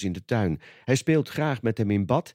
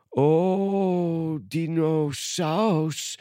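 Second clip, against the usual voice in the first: put-on higher toddler voice, very slow and wondering; little George in awe; 6 semitones higher, much slower than usual. A narrator's reading. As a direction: drawl it out like a tiny boy amazed. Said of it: "zegt George".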